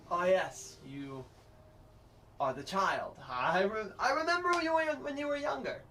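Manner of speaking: pompous Pudgemore. Acted. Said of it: "Pudgemore voice".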